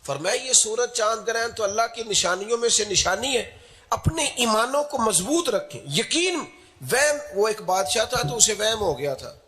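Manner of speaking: high, insistent preaching; urgent exhortation. Real, not acted. Preacher urging dear friends to firm faith, high forceful exhortation.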